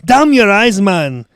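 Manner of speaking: loud, high Scottish bellow; angry Scottish accent. Acted. Boss scolding his groundskeeper Carl, angry Scottish shouting. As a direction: tear into him as an irate Scotsman. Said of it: "And he's, and he's angry".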